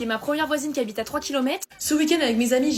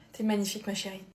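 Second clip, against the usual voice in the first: softer, lower loving tone; tender compliment; 5 semitones lower, 6 dB quieter than usual. Acted. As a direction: say it gently to your darling. Compliment tendre. (compliment doux à sa chérie, voix plus basse)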